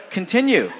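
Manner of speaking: normal talking voice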